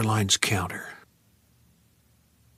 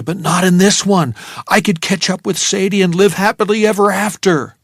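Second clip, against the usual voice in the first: giddy relief; 7 semitones higher, 7 dB louder than usual. A narrator's reading. Narrator's giddy relief, no Oswald.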